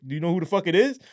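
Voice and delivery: high-pitched innocent question; playing it off. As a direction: ask as if you have no idea. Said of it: "I'm trying to play it off".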